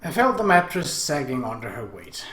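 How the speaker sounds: plain reading voice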